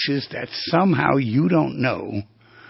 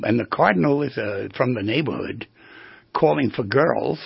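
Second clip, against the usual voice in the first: ordinary talk, nothing marked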